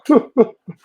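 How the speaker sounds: a loud high cackle; loud laugh. Real, not acted. Laughs at own joke.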